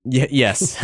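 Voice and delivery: usual speaking voice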